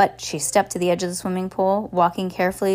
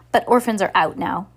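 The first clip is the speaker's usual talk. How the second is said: ordinary delivery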